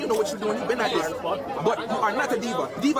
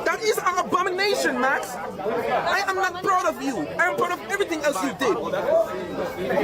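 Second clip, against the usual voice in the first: higher, slow scolding of Max; mock disappointed parent; 6 semitones higher than usual. Parent scolding Max for calling himself a diva, mock disappointed. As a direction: pitch up and lay the disappointment on thick.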